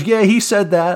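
high, loud confirmation from across the room; retold called-back answer. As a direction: imitate a witness calling back. Witness answers the yell across.

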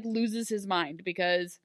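ordinary delivery

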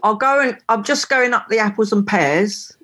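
a light Cockney lilt on the slang line; Cockney rhyming slang said plainly with a hint of London; Cockney accent. Shown as a demonstration. Asked to show Cockney, speaks lightly Cockney. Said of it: "Want me to talk a little bit of Cockney then".

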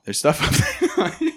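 laughing. Real, not acted. Laughing at own gross story.

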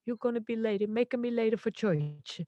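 put-on high-pitched broken-English Italian accent; Italian grandmother accent. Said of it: "broken English Italian accent".

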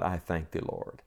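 soft, low words to God; breathed prayer. Shown as a demonstration. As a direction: breathe it quietly as a private prayer. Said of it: "breathe them to God".

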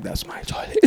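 whispered through a laugh. Retold or imitated. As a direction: breathe it out laughing. Laughing aside.

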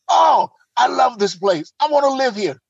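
acted-out delight; delighted reply. Acts out his delighted reply.

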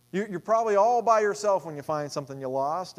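ordinary delivery

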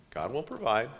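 soft, patient answer; calm reassurance. Retold or imitated. Moses calmly reassuring the thirsty Israelites, softly. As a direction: gently settle them down. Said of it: "the argument kinda went like this".

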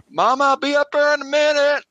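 high call up the stairs to Mama; calling upstairs. Acted. Father calling up to his wife from the shop. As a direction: shout it up to the next floor.

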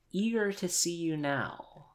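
usual speaking voice

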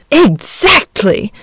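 slow, pleasant smile in the voice; smiling pleasantly. A narrator's reading. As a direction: agree with a gentle smile. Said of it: "smiling pleasantly".

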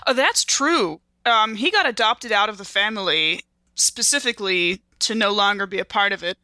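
ordinary delivery

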